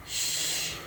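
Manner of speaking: breathy shushing whisper; a soft secret shh to keep the king quiet; hushing whisper. Acted.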